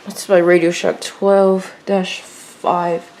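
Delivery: ordinary delivery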